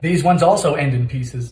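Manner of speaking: ordinary talk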